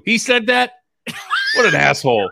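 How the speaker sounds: indignant actor voice; mock outrage. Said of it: "He says".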